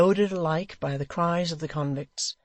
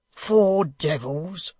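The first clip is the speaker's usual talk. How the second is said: very slow, louder scornful cry; hangman's cry; 2 semitones higher, 5 dB louder, much slower than usual. Acted. The hangman crying out at Hugh, slow scornful cry. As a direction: spit the words out slowly. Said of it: "cried the hangman".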